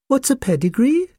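very high, innocent little puppy; bewildered tiny creature, pitched right up; small puppy voice. A narrator's reading. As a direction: ask it in a tiny squeaky voice. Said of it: "in an extremely small voice".

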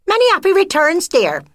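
high, loud, fast twittering friend; shrill-pitched gushing neighbour mouse, rushed. Acted. Friend greeting the widow on her birthday, high gushing twitter.

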